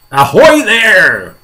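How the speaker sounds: high, drawn-out hail; shouting. Acted. Police chief hailing the creatures on top, long shout. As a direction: call up loudly, stretching the words. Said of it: "shouted the police, chief of police".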